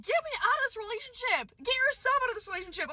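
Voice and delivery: high, squeaky retold plea; panicked plea. Wanting out of his cheating mess, high panicked plea. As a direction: beg in a high, panicky pitch.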